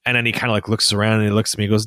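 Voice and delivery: ordinary delivery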